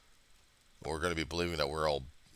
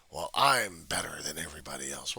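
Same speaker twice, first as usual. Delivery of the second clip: smug superiority; 4 dB louder than usual. Retold or imitated.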